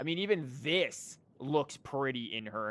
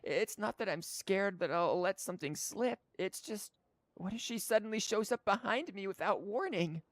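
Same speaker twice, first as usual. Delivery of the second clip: put-on high, timid little girl; nervous child voice; small child voice; 9 semitones higher than usual. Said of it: "I don't know how to do small child".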